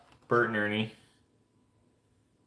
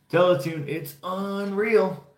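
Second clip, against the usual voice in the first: slogan imitation; 9 semitones higher, slower than usual. Quoting the channel slogan.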